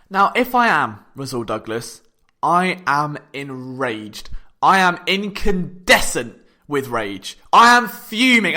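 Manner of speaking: slow, low, every "I am" weighted; slow and deliberate. Real, not acted. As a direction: spell out the fury slowly. Declares rage on the player's behalf.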